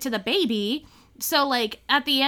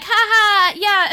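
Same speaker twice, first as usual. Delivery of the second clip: bright fake laugh, louder; mocking mom laugh; 7 semitones higher, 8 dB louder, much slower than usual. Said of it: "She was like".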